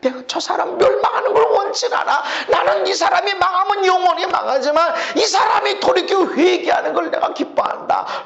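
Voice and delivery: high, urgent preacher voicing God; God pleading earnestly. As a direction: answer the complainer with impassioned insistence. God answers the complainer earnestly.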